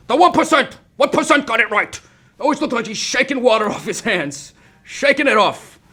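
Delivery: a lightly voiced, badly done Bernie rant; Bernie Sanders impression. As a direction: shout it like a fiery stump speech, badly. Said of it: "worst Bernie Sanders impression".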